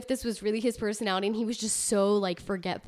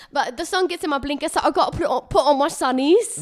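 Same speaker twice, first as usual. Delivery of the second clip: raised-pitch mock English woman; hammed-up English accent, pitched up; female English accent; 4 semitones higher, 4 dB louder than usual. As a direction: explain in a put-on English accent. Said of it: "like, female accent take".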